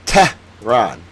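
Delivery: Tehran drawn out slowly, "TEH... rahn"; slow, needling "TEH... rahn"; mocking over-pronunciation. Shown as a demonstration. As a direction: hammer "Tehran" as two slow separate syllables. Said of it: "again, Tehran".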